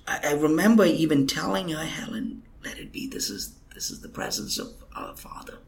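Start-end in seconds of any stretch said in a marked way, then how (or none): 1.8-5.6 breathy whisper, barely voiced; hushed whisper. Speaker hushing a weeping coworker during a holy moment, whispered. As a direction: soothe her in a hush, let it be. Calming weeping Helen in God's presence.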